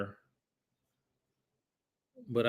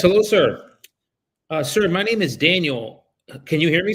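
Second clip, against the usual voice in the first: higher, louder, polite cold-call voice; phone sales voice; 5 semitones higher, 5 dB louder than usual. Real, not acted. Broker cold-calling a homeowner about his listing, upbeat phone sales voice. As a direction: brighten up and pitch yourself to the homeowner.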